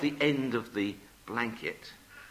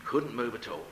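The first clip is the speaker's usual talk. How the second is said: ordinary delivery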